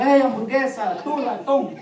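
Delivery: playful taunting. Retold or imitated. Kids teasing each other with nicknames, playful taunt. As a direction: tease like kids trading silly nicknames. Acted children's teasing name-calling.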